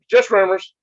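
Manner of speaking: playfully innocent; coy. Pretend. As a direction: brush it off with a sly lilt. Coy denial of rumors.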